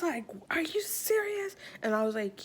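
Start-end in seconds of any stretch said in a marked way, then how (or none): 0.5-1.5 high-pitched incredulous question; hammed-up astonishment, pitched right up; mock disbelief. Youth pastor questioning the girl, mock disbelief.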